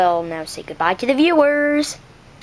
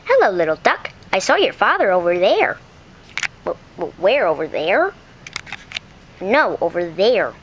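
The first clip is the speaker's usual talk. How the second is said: a stranger pointing the duck; low stranger voice; 8 semitones lower than usual. Acted. Stranger telling the duck where its father is, low. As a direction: lower voice, pointing the way.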